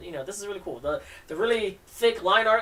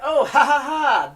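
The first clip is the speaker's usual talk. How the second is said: nervous laugh; 10 semitones higher, 10 dB louder, slower than usual. Acted. Embarrassed boy laughs in comic.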